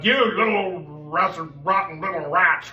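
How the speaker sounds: high, loud scolding teacher parody; mock screaming. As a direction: yell at the class, hammed up. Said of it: "I'm not screaming".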